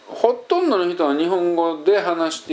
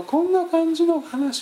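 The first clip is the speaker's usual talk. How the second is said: very high voice that does not carry; non-resonant way of speaking. Shown as a demonstration. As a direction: try out a high, swallowed-up voice. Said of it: "こんな感じの話し方かな". (響かない高い声の話し方)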